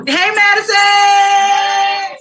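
long, high, loud call of the name; calling out Madison across the room; drawn-out shout. Real, not acted. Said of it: "I'm shouting out Madison".

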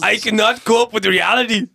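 high, loud German accent attempt; hammed-up, shouted German accent; bad German accent. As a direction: belt it out in mock German. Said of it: "Do a German accent right now".

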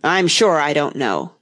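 usual speaking voice

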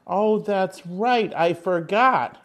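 high, slow, hammed-up realization; mock surprise. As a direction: drag it out in fake shock. Forgot their wedding anniversary.

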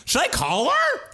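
very high-pitched mock-innocent question; high, imitated clueless asking; mimicked clueless suggestion. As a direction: squeak it out as an imitated naive offer.

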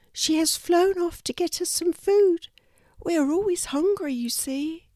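high, small bird voice; a lost little chick explaining herself; kookaburra chick voice. A narrator's reading. Baby kookaburra telling the kangaroo how she fell, small high voice.

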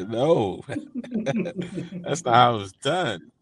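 amused protest through laughter; real laugh breaking into the words. Real, not acted. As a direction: object while really laughing. Amused reaction to the tweet.

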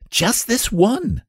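put-on high, drawn-out childish plea; very high-pitched pleading; eager cry. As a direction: beg for it like an eager boy. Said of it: "cried Lionel".

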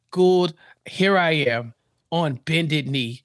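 slow, low, deliberate begging act; mock solemn plea. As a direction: draw it out low like a staged entreaty. Mocks Alex's staged pleading on tape.

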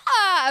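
high, loud excited shriek; piercingly high scream of celebration; Teresa shriek. Acted. Host celebrating guest's correct guess, loud shriek. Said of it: "I did my Teresa shriek for you".

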